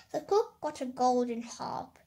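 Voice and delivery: ordinary delivery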